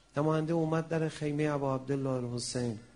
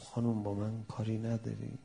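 softly, lower-pitched question; quiet voice; 4 semitones lower, 7 dB quieter than usual. A narrator's reading. Zuhayr at the tent door to his wife, quiet voice. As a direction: ask it gently under the voice. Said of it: "با صدای آروم گفت".

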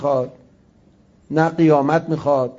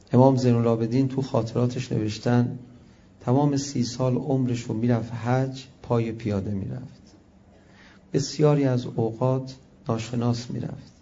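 ordinary delivery

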